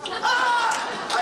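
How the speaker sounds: high startled shriek with a laugh. Acted. Guest shrieking at the clerk's reply. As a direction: let out a sudden high shriek. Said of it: "I shrieked".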